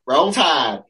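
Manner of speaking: imitating a Southern college girl chanting Roll Tide; mock Southern accent. Girl announcing her college in a mock Southern accent. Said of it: "those accents".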